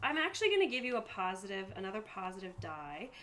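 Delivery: usual speaking voice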